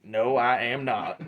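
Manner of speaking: ordinary delivery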